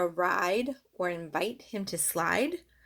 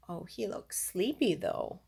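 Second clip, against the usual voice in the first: usual speaking voice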